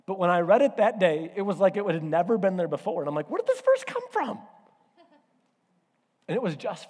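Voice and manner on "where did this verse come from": much higher, wide-eyed wonder; amazed. Retold or imitated. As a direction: gasp it out in sudden discovery. Amazed at a Bible verse.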